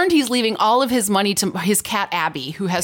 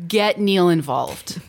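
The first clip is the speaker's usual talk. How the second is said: ordinary talk, nothing marked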